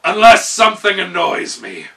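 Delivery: loud, menacing finish; gnome king. A narrator's reading. Gnome king warning his steward, loud and ominous. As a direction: let the threat land.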